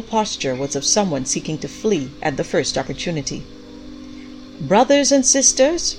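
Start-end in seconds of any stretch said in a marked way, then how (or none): 4.6-6.0 high, loud, slow address; a little breathless. A narrator's reading. Mother addresses the congregation, a little breathless and high. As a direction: call the congregation to attention, a touch breathless. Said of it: "said a little breathlessly".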